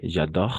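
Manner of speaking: "j'adore" ending on the French r; silent final E. Shown as a demonstration. Teacher showing learners the ending, "j'adore" with final e silent. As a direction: say "j'adore" as zha-DOR, no vowel after the r. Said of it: "la E al final no pronunciamos".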